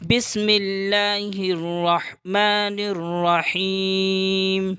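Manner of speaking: slow, drawn-out Quranic recitation; measured tajweed chant. Acted. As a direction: intone it slowly and reverently. Opens the lesson with recitation.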